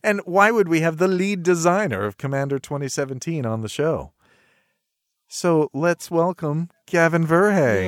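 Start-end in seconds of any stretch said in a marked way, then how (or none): 5.3-7.9 slow, drawn-out guest welcome; host announcement. Acted. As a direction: introduce the guest like a showman.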